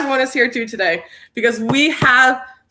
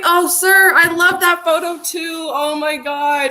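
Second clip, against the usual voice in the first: high and delighted; excited; 7 semitones higher, slower than usual. Real, not acted. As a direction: gush with delight. Reacting to viewer's comment.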